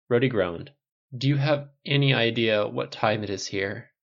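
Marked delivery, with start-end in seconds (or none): none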